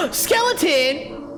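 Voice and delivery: high, slow excited shout; excited gasp. Real, not acted. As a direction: gasp and call it out thrilled. Spotting a skeleton on the box.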